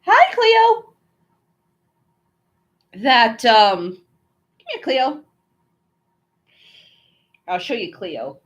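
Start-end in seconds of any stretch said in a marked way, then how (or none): 0.0-0.9 high, sweet voice for the dog; pet talk. Real, not acted. Greeting her pet dog. 3.9-5.2 high, soft coaxing call; sweet high call to a dog; pet talk. Real, not acted. Calling her pet over.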